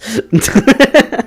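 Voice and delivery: a high rising burst of laughter; real laugh. Real, not acted. Streamer laughing at her own slang, high amused laugh.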